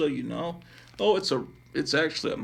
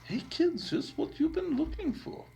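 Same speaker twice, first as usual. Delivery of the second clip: badly faked high-pitched robot impression; C-3PO. Reading a Star Wars ad to kids, poor C-3PO impression. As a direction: attempt a high droid voice, not quite managing it. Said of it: "I can't do a C three P O impression".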